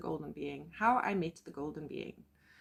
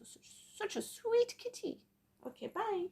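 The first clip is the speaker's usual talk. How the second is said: cooing to pet; 13 semitones higher, 5 dB quieter than usual. Real, not acted. Woman seeing off her sweet kitty, high cooing. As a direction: coo sweetly in a tiny high voice.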